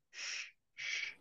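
retold guide shushing in a whisper; imitated shushing. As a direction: hush him the way the guide did. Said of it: "he kept saying".

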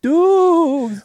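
ominous echo. Retold or imitated. Echoing the doom line.

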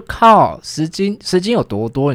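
loud, high disbelieving exclamation; shocked outburst. Retold or imitated. Hearing mom wants ten jin, loud shocked cry. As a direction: blurt it out in shock. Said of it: "我就".

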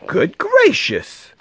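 high, drawn-out shock; astonished storybook squeal; gasped. A narrator's reading. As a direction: exclaim it slowly, way up high. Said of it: "gasped Maisie".

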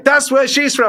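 very high, loud mock Northern Irish; Northern Irish accent. Punk guy recognises the girlfriend's town, imitated Northern Irish accent. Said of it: "he was Northern Irish, and he goes".

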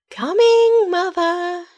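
high, loud sing-song call. Acted. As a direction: call down the tower. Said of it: "she called down the tower".

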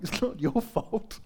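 soft, slow, kind reassurance; gentle reassurance. Retold or imitated. Patient consoles apologetic nurses, soft and slow. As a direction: let the nurses off the hook gently.

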